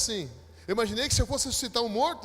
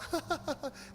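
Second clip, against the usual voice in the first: high, soft mock cackle; imitated crowd laughter; 8 semitones higher, 6 dB quieter than usual. Bystanders laugh at embarrassed believer, mock cackle. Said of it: "começaram a rir alto".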